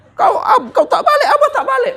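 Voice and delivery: high, exasperated mock scolding; indignant father snapping back; exasperated retort. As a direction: throw it back at him in disbelief. Father scolding driver in anecdote.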